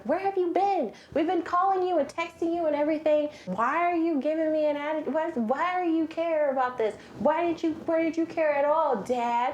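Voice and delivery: high, fast accusing family; family confronting. Retold or imitated. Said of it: "everyone rushes down and is like".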